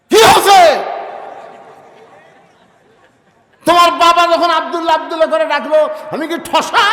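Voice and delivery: wife screaming back at her husband about his father; furious shout. Acted. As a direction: yell it at him in outrage.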